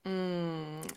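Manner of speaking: ordinary delivery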